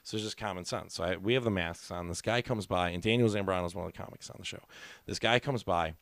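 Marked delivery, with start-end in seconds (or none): none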